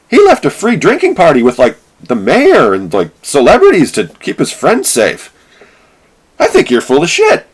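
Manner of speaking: incredulous at the unfairness; heated mock outrage; outraged disbelief. Real, not acted. Speaker's outraged rant.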